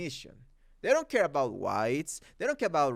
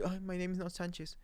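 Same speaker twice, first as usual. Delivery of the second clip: meek quote; 11 dB quieter, faster than usual. Retold or imitated. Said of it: "the guy is always like".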